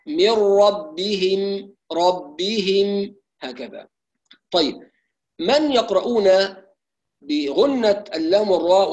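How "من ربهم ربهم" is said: ربهم very slow with full kasra on ba and ha; full kasra, clear ha. Shown as a demonstration. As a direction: draw out ربهم, every vowel complete. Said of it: "نعتني بإتمام كسرة الباء والهاء مع تحقيق الهاء". (ربهم ببطء مع تحقيق الهاء)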